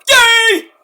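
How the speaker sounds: a high, loud squeal of delight; excited cheer. Real, not acted. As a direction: cheer her on like a fan. Cheering Thunder Rosa's appearance.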